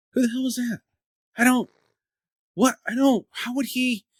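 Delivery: high-pitched, halting disbelief; retold bafflement. Runner hears a stranger use his name, acted bafflement. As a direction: replay being baffled, stumbling over questions. Acting out confusion at being named.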